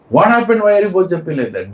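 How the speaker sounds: high, quick, acted exasperation; exasperated wife. Voices wife scolding dancing husband.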